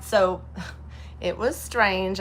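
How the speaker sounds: ordinary delivery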